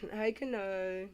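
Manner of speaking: ordinary delivery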